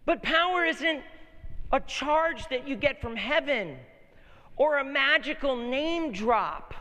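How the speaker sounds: preaching at full force, high and loud; shouted sermon delivery, key words stretched out; impassioned preaching. Real, not acted. Preacher proclaims Jesus' power to the congregation, impassioned.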